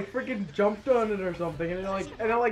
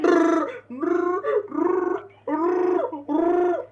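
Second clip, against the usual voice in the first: high buzzing brrr noise, louder; rumbling buzz; 8 semitones higher, 4 dB louder, much slower than usual. Retold or imitated. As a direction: imitate a rattling controller rumble. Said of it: "they probably went".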